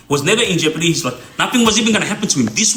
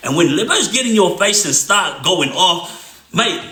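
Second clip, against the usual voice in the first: ordinary talk, nothing marked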